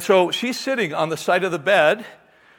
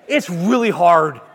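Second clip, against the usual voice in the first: ordinary delivery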